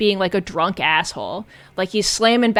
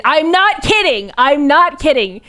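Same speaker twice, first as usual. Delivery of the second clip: loud, high insistence; emphatic insistence; 5 semitones higher, 4 dB louder than usual. Real, not acted. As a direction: insist it's true, pitched up. Insisting the movie really does this.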